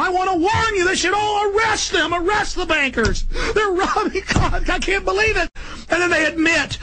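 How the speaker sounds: high, strained shouting rant; frantic shout. Real, not acted. Ranting to listeners about bankers, high frantic shouting. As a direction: yell the warning at the top of the voice.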